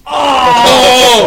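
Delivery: loud high yelp; startled shout. Real, not acted. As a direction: caught off guard, yelp. Startled by camera view.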